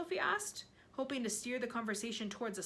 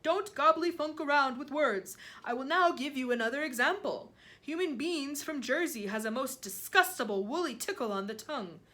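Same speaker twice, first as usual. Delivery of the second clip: loud, higher giant voice; giant lecturing on flavours; 4 semitones higher, 7 dB louder than usual. A narrator's reading. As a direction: hold forth emphatically as the giant.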